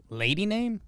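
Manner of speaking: normal talking voice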